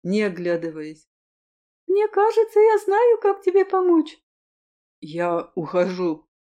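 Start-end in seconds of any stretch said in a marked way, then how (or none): 1.9-4.2 put-on high, louder young woman's voice; princess voice. 5.0-6.3 low, slow male voice. Acted. Hero answers coldly.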